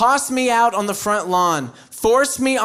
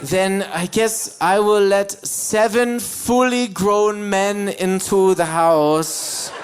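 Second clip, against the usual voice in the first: mock scolding parent. Bavarian parent threatening a thieving child, mock stern. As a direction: menace a child with theatrical deliberateness. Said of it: "parents in that area like to use these horrifying monsters".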